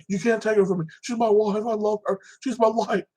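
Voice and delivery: a hint of a desperate man begging for his wife; pleading killer. Prisoner begging the guards to let him keep his wife, lightly voiced desperate plea. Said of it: "He's like".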